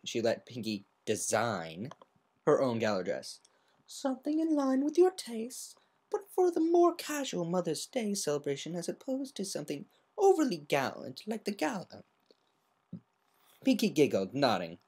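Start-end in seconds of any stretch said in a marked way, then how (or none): 3.9-12.0 higher, softer genteel lady voice; politely steer her friend away; refined Rarity. Acted.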